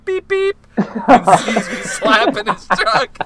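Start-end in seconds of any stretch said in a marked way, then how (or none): 0.1-0.6 high, loud imitated car horn; mock horn beep. Retelling the truck owner's horn, a high loud mock beep. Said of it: "fucking Buddy turns around to go".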